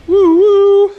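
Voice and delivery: high, loud train whistle call; train whistle woo. Pretend. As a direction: hoot it like a steam train. Train whistle for man kebab.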